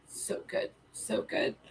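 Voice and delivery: hushed, almost voiceless murmur; overcome with emotion. Real, not acted. Streamer moved by the song, whispering with emotion. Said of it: "super emotional".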